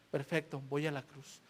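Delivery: low, very soft resignation; submissive acceptance. Acted. Jesus agreeing to go to the cross, low and submissive. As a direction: accept quietly, sinking low.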